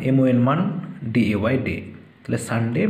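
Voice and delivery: ordinary talk, nothing marked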